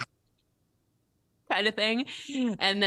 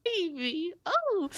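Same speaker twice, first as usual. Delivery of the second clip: cooing at a pet; 14 semitones higher, much slower than usual. Real, not acted. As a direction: melt over the animal.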